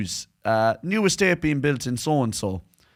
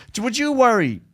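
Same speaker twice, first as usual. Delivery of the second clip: usual speaking voice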